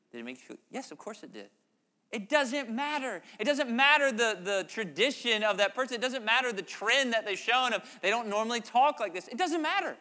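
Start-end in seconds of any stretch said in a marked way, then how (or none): 2.1-10.0 louder and higher, pressing each "doesn't matter"; emphatic preaching. Real, not acted. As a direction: preach it with rising force. Preacher building emphatic point.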